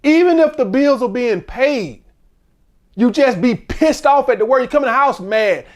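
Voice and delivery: usual speaking voice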